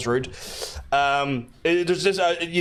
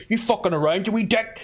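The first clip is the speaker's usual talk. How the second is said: higher mock-threatening Belfast accent; rough Belfast cabbie; 6 semitones higher than usual. Cab driver confronting someone, mock Belfast menace. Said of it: "I mean, Phonacab, you're likely to".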